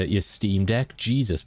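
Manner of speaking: ordinary talk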